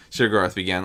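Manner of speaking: ordinary delivery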